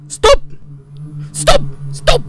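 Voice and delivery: loud, high panicked yell; sharp scared shout of stop. Real, not acted. Yelling at the monster.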